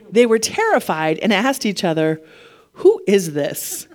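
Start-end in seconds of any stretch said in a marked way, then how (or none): 2.7-3.9 slow, hushed-in-wonder question without whispering; awed question. Acted. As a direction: let each word hang in amazement. Reads the disciples' stunned question.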